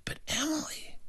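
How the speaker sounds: whispered. A narrator's reading. Hushed turn in the story.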